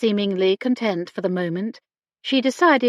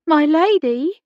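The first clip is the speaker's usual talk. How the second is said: louder repeat; 8 semitones higher, 5 dB louder than usual. A narrator's reading. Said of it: "repeated more loudly".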